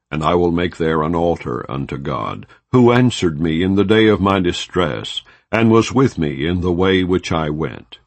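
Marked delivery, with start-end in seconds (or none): none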